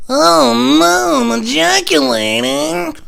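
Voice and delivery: high, loud, drawn-out wail; hammed-up cry of dismay; mock wailing. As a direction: wail helplessly, dragging the words out. Helpless mock wail at climax.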